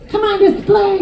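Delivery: mock kid voice. Kid begging to play at park.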